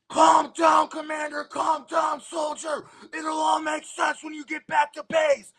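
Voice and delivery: high, forceful calm-down shout over the noise; shouted order. Retold or imitated. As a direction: bark them into calm over the chaos. Agents shouting over screaming soldiers.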